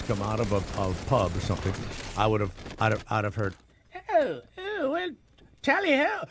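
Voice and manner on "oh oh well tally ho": much higher, hammed-up posh British twit; squeaky mock-English toff voice; mock British soldier. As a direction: play a drunk redcoat chirping his catchphrase. Mimics British soldier from pub.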